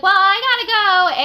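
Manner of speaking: loud, high, hasty goodbye; imitated flustered exit. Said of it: "he's trying to, like, save face".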